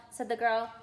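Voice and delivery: ordinary delivery, nothing marked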